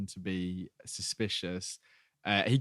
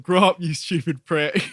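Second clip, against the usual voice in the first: loud, higher retold insult; mock quarrel; 6 semitones higher, 8 dB louder than usual. Podcaster retelling Shiv's row with George, mock quarrel. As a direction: playfully imitate an exasperated telling-off.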